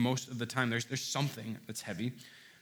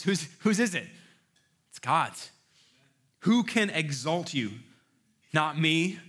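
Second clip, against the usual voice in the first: louder and higher, slow and emphatic; full-force preaching; 4 semitones higher, 4 dB louder than usual. Acted. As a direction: preach it out to the whole room, hammering each point.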